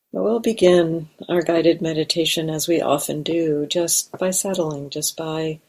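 calm, unhurried meditation guidance with long pauses; guided meditation. Real, not acted. Teacher guiding meditators to arrive in the body, guided meditation. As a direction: settle listeners gently, leaving space between phrases.

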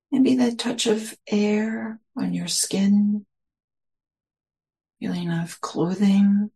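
slow, spacious meditation guidance with long pauses; guided meditation. Real, not acted. Teacher settling listeners into their senses, slow guided meditation. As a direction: lead them gently inward, phrase by unhurried phrase.

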